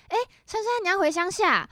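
high, loud singsong tease; mock teasing classmate. Taipei classmate teasing her on the bus, high singsong. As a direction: tease her like a smug city kid.

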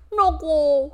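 muffled behind hand; hand over mouth. Acted. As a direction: talk through covered mouth. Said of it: "捂着嘴巴说".

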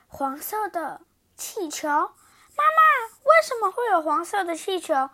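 building from disbelief to panic; rising distress. Acted. Princess calling to her mother, rising into a wail. Princess upset over balloon.

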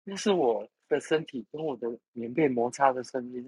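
high, startled exclamation; startled cry. Retold or imitated. Retelling the caller's startled exclamation, high surprised cry. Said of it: "惊呼了".

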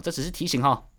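ordinary talk

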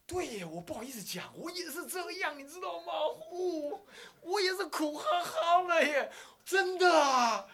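overjoyed to find a kindred soul; a mock-gushing classmate finding a soulmate; gushing relief. Friend gushes relief at shared gripe.

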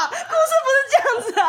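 high, loud, fast insistence; laughing shout. Real, not acted. 再次大声反驳. (笑着大声强调)

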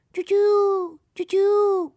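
high, drawn-out bird calls; imitated high tweets; pigeons chirping. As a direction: chirp like birds in a tree. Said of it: "树上那些鸽子啊".

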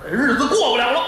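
hint of a drunken husband; angry outburst. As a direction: slam it down in disgust. Drunk husband smashes bowl.

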